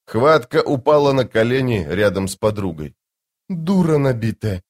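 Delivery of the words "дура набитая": plain reading voice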